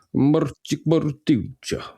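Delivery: put-on slow, sultry Eastern European accent; mock Eastern European. Comedian imagining [name] in Polish, sultry Eastern European accent. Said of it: "I like the Eastern European accents. Quite sultry".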